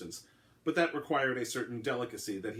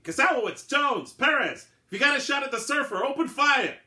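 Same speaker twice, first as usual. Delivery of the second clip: high, loud radio command; yelled order; 9 dB louder than usual. A narrator's reading. Lieutenant ordering his snipers over the radio, yelling. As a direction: bark the fire order down the line. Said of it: "yelled into his walkie-talkie".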